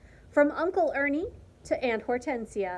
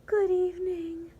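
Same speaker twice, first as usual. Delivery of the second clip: lovestruck sigh; 7 semitones higher than usual. A narrator's reading. Martina greeting the cricket she loves, high and sighing. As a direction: sigh it out, smitten. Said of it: "sighed La Cucaracha Martina".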